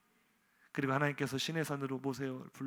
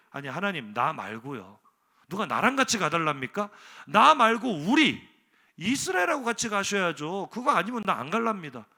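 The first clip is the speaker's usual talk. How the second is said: 1 stubborn insisting; 4 semitones higher, 8 dB louder than usual. Acted. Moses voiced insisting on the people.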